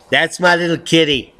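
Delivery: ordinary talk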